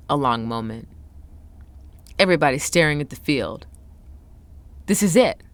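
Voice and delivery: measured build to an emphatic, suspenseful reveal; dramatic story narration. A narrator's reading. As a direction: narrate it like a tense sports tale, with dramatic pauses. Reads a dramatic story passage aloud.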